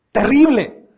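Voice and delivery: sudden loud, high cry of terrible; loud rebuke. Retold or imitated. Preacher rebuking the pastor, loud reproachful cry. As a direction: shout the word out in reproach.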